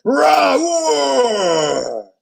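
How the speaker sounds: gorilla roar. A narrator's reading. Gordon roaring, springing to save Abu. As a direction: bellow it out in rage. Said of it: "he roared".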